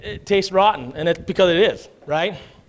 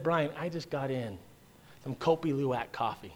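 hushed tempting invite; 5 semitones lower, 10 dB quieter than usual. Acted. Voicing friend's coffee invite.

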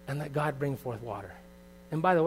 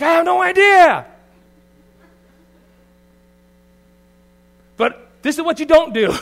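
shouted much higher and louder, half laughing; mock yell; 15 dB louder than usual. Husband yelling at his wife in a mock outburst. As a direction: yell it back in a hammed-up outburst. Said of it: "And I'm like".

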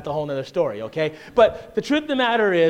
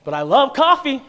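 emphatic declaration; 7 semitones higher, 7 dB louder than usual. Real, not acted.

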